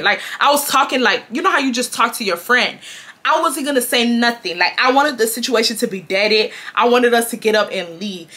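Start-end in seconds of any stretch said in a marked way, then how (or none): none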